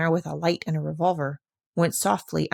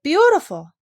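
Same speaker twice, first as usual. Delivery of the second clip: higher, louder cry of beautiful; girl's last word before sobbing; breaking into tears; 9 semitones higher, 6 dB louder than usual. A narrator's reading. Heidi breaks into weeping.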